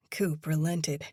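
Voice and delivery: plain reading voice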